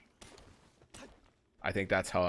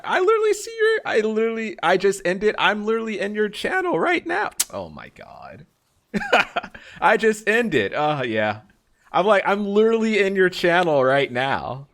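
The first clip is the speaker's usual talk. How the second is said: high, loud, tumbling over the words; can't believe they're in your channel right now; excited disbelief; 13 semitones higher, 8 dB louder than usual. Real, not acted. Surprised streamer tells a viewer she's in their channel, excitedly.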